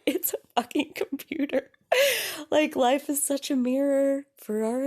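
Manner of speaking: high laugh through words. Real, not acted. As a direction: laugh at yourself. Laughing at own anger.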